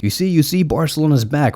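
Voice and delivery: crowing like a smug fan; gloating fans. Retold or imitated. Fans hyping a win over Betis, mock-excited crowing. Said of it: "and they were like".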